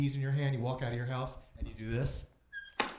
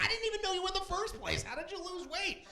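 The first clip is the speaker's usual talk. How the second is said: mock audience voice; 4 dB quieter than usual. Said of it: "You're like".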